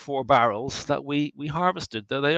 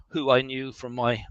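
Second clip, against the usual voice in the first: ordinary talk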